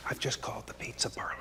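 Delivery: hushed, voiceless aside to the audience; whispered aside. Real, not acted. Caller whispering to the audience during the call, quick hush. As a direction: whisper it so the clerk can't hear.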